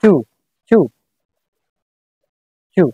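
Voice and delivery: চু said as chu, not tu; saying chu. Shown as a demonstration. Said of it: "উতে হবে". (চু, তু নয়, স্পষ্ট উচ্চারণ)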